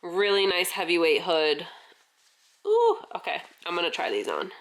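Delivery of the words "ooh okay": excited. Real, not acted. Excited reaction to new merch.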